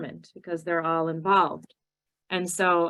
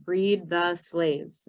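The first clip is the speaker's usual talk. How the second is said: slow deliberate answer; much slower than usual. Shown as a demonstration. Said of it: "Also for Emancipation Proclamation".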